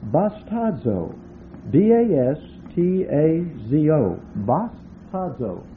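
bastazo, slow and syllable by syllable; Greek word said slowly. Shown as a demonstration. Preacher teaching the Greek word to the congregation, slow and careful. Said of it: "looks like this in the Greek".

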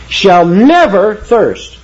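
"never" stretched out and high, slow and emphatic; underscored. Shown as a demonstration. Preacher underscoring the Lord's promise with "never", emphatic. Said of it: "The Lord is underscoring".

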